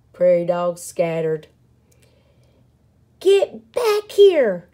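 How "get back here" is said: high, loud, slow-punched yell. A narrator's reading. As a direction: yell a command after a scattering crowd. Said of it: "yelled Pip".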